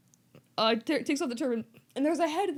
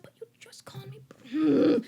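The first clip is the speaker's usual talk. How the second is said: whispered whine; 7 semitones higher, 3 dB louder than usual. Retold or imitated. Harry protests to Quirrell.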